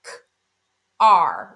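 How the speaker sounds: "K", pause, "R": each sound alone, the R loud; sounds isolated. Shown as a demonstration. Teacher isolating the sounds in car, said separately. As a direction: break off "K", then hold a strong "R". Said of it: "when we isolate those sounds".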